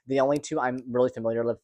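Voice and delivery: rushed, tumbling delivery; talking too fast. Real, not acted. Vlogger rushing through a list of surgeries, talking too fast. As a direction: rattle it off quickly. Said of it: "I'm sorry I'm talking so fast".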